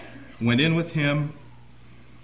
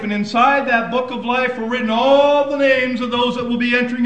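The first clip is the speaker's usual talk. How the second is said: raised, loud preacher's voice; fiery preaching; 12 semitones higher, 11 dB louder than usual. Acted. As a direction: preach it to the congregation. Preacher building up intensity.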